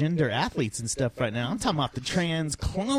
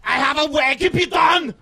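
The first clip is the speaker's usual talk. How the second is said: mad scientist voice; 11 semitones higher, 8 dB louder than usual. Acted.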